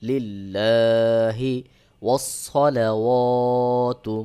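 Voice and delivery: very slow chant with long-held vowels; tajweed recitation. Shown as a demonstration. Teacher recites the joined phrase for learners, slow and drawn out. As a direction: stretch each long syllable evenly.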